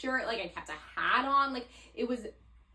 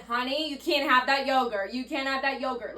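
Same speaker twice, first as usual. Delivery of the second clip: imitated condescending agent, raised voice; loud, controlled mock refusal; mock neutral yelling; 3 semitones higher, 7 dB louder than usual. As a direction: scold while acting like it's neutral. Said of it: "they're neutral".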